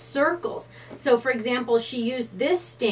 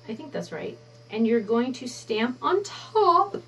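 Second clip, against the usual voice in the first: normal talking voice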